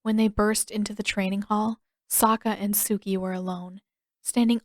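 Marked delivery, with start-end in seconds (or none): none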